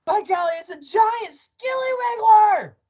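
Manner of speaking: very high, loud shriek; alarmed shout. A narrator's reading. Husband frightening wife about a creature, shrieking. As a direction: cry out in hammed-up, high-pitched alarm.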